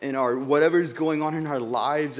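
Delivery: ordinary talk, nothing marked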